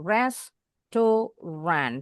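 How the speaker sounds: "Restaurant" very slowly split as res-to-ran; three syllables. Shown as a demonstration. Teacher counts syllables for students, "Restaurant" as three slow separate pieces. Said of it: "Ini ada tiga suku kata".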